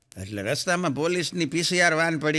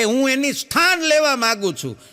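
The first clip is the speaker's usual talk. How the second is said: eager MLA; 8 semitones higher, 4 dB louder than usual. Retold or imitated. MLA's eager request.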